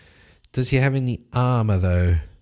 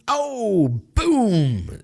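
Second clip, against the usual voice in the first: high, excited sound-effect boom; excited impact cry; 10 semitones higher than usual. Acted. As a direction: shout the hit like a cartoon crash. Narrating a hammer blow.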